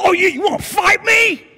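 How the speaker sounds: high, loud hammed-up challenge; shouted mock fight-picking; mock hostility. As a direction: play an imagined angry stranger squaring up. Imagined hostile reply.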